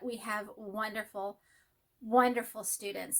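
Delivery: ordinary talk, nothing marked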